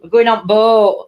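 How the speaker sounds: loud, broad northern "boat" with a long O; exaggerated northern accent. Shown as a demonstration. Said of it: "Just lengthen the O's".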